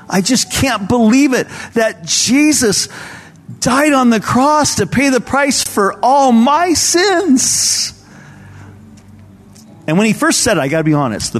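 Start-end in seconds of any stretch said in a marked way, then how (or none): 0.1-7.9 put-on very high, drawn-out child's voice, overcome; imitated weeping boy. Weeping twelve-year-old explaining himself at communion, imitated tearful wonder. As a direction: imitate an overwhelmed young boy, stretching the words. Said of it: "he was just weeping".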